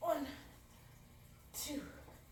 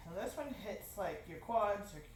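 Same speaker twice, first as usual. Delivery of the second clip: ordinary talk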